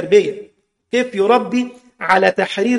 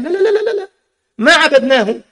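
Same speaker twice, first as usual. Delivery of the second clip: much higher, louder, rapid protest; insistent denial; 6 semitones higher, 3 dB louder than usual. Retold or imitated. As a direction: protest hard, no no no. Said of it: "قال". (إنكار متحمس بصوت أعلى)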